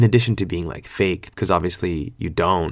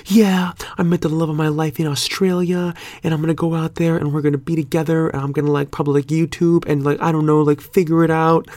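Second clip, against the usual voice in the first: high-pitched, mock-excited gushing; gushing past self; 9 semitones higher than usual. Telling rideshare passengers about moving to Australia, mock-excited gushing. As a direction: gush it in a high, sustained voice.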